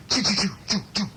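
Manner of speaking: rapid playful "choo" sound effect; imitated zapping. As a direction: fire off little puffs, one by one. Imitates spots shooting onto child.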